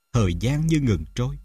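usual speaking voice

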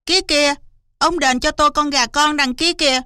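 high, excited fox voice; high-pitched, pushy little fox; eager fox. Acted. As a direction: point it out greedily and demand it. Fox eagerly demands the chick.

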